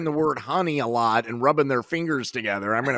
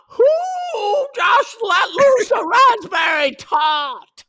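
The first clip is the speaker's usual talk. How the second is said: loud, high, slow Cockney shout; Cockney accent; 6 dB louder, much slower than usual. Pretend. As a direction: bellow it in broad Cockney. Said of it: "Can you say raspberry tart?".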